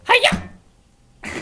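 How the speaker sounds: high, loud hi-ya; karate yell. Acted. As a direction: shout it like a kung-fu kick.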